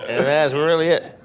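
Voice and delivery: ordinary delivery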